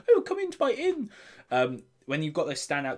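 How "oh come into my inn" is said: very high put-on welcoming voice; stock innkeeper. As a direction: squeak out an innkeeper's invitation.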